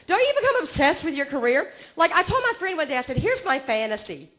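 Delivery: usual speaking voice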